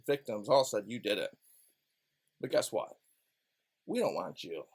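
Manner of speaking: low, quiet sneering prosecutor; mock prosecutor. Prosecutors pressuring Brian to lie, low and scheming.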